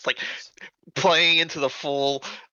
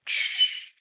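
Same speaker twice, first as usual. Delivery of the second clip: hushing. Acted. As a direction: shush them for a sleeping baby. Hushing by the sleeping baby.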